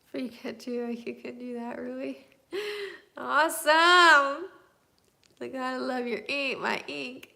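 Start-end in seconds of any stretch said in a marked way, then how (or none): 2.5-3.1 light laugh. Real, not acted. Laughs at a viewer comment. 3.2-4.5 loud, slow, drawn-out cheer; excited shout. Real, not acted. Excited about free tattoos.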